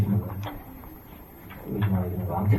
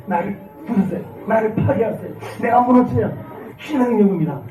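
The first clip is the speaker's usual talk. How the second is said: fervent preaching; 8 semitones higher than usual. Acted. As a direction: pour it out like a revivalist preacher. Preaching climax. (높고 힘찬 설교조)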